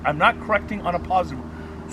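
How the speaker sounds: usual speaking voice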